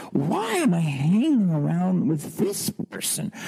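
pleading inner voice building up; mounting self-questioning. Retold or imitated. Man asking himself why he lives this way, rising pitch.

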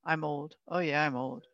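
put-on low, soft, resigned old-person grumble; imitated old person. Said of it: "hear people say".

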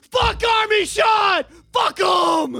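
high, loud hammed-up shouts; mock furious movie-scene yell. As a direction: scream it at the top of your lungs. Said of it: "Let's just start yelling".

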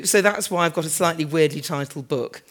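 normal talking voice

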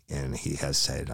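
ordinary delivery

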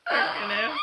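high squealing laugh through the words. Real, not acted. Laughing after podcast remark.

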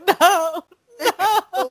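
high, half-laughing no, pitch way up; mock tearful protest. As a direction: whimper a playful plea against the sad scene. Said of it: "I'm like".

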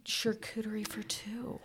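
soft unvoiced whisper. Real, not acted. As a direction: whisper it like a secret. Playful whispered suggestion.

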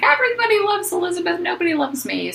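high, quick imitation of a sulky complaint; mock self-pity. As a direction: start very high and fast, hamming up the poor-me lament. Mimicking Jessica's self-pitying complaint.

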